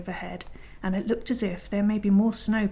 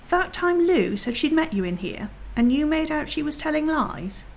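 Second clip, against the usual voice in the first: high-pitched, incredulous reproach; indignant accusation; 7 semitones higher than usual. Acted. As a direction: confront the liar, voice raised in pitch. Peter confronts Edmund's lie.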